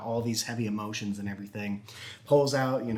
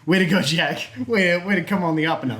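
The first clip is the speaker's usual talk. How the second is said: louder, higher, fast cheering; mock cheer; 6 semitones higher, 7 dB louder, faster than usual. Teller hyping up Jack's comeback, mock cheering. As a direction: cheer him on, hammed-up.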